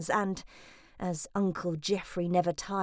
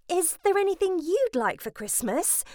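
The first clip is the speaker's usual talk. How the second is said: desperately; 10 semitones higher than usual. Acted.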